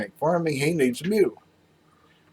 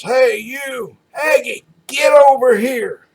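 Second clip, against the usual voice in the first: high, loud calling shout across the field; angry hollered call; yell; 13 semitones higher, 7 dB louder, slower than usual. Retold or imitated. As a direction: holler across the fence at the Aggie. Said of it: "and he yells".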